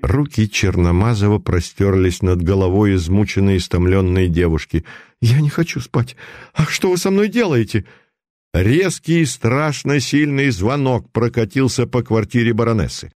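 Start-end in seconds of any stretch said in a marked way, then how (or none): 5.2-7.8 desperate protest. Acted. Girl resisting hypnosis.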